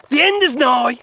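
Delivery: high, loud mock doom; hammed-up prophet of doom. Mock doom after grim news.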